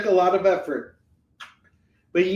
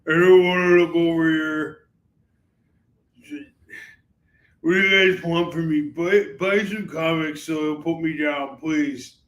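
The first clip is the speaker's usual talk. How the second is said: voicing the dog; 4 dB louder than usual. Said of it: "He's like".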